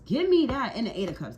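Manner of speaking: loud, high-pitched punchy cry; emphatic exclaiming. Real, not acted. Tarot reader claiming a card, emphatic shout. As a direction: shout it in triumph over a find. Calling out cards as drawn.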